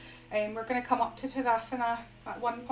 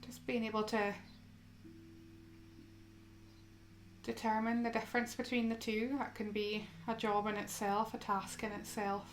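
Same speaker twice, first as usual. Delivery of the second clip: soft, unhurried yoga guidance with long pauses; guided meditation; 10 dB quieter than usual. Acted. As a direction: gently guide them into stillness, phrase by phrase.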